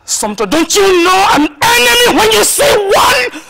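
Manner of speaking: a mother shouting down her defiant daughter; furious shout. Acted. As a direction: rage at her daughter's foolishness. Mother scolding daughter about enemy.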